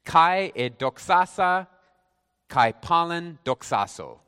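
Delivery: slow, deliberate Greek recitation; measured reading of the original Greek; word-for-word Greek. Shown as a demonstration. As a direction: pronounce each Greek word slowly and distinctly. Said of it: "give you the Greek as it is".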